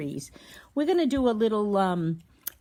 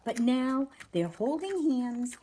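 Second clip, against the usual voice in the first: ordinary delivery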